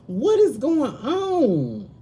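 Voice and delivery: slow, high and puzzled; slow, puzzled question. Retold or imitated. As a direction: drag out the question in disbelief. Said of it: "I'm like".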